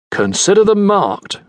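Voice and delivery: put-on a lieutenant brushing off a sailor, clipped British accent. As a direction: dismiss him in a precise British accent. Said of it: "in his clipped British accent".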